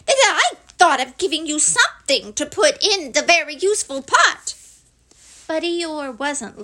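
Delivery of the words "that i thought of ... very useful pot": quick, high, eager Piglet; high-pitched, hurried delight; happy. A narrator's reading. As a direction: chime in happily in a high, loud voice. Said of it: "said Piglet happily".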